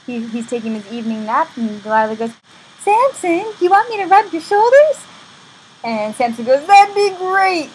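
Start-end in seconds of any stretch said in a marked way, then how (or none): 2.8-5.0 high, loud put-on sweetness; hammed-up coaxing lover; coaxing Delilah. Delilah seductively offers massage, acted. 6.7-7.7 high, loud, drawn-out acceptance; hammed-up relieved delight; eager Samson. Samson accepts massage, acted.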